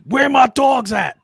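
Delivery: high, loud, slow booming shout; hammed-up Giant yell; mock Giant shout. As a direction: bellow it like a giant wrestler. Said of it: "The Giant says".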